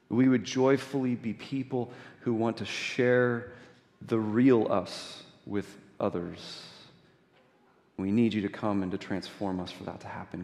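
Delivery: soft, hushed closing prayer. Acted. Pastor closing the prayer before the sermon, hushed and soft. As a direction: pray it quietly to close.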